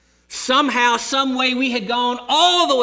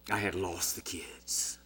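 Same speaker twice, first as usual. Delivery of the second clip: hushed whisper; 12 semitones lower, 12 dB quieter than usual. Retold or imitated. Dread recalled, losing children.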